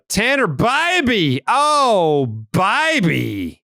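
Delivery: high, loud, drawn-out name call; hammed-up swooning shout of the name; playful exclamation. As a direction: sing out the name high and slow, hamming it up. Repeats the name gag.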